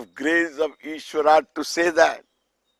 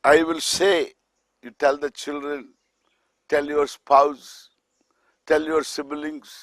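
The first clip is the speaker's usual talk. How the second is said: ordinary talk, nothing marked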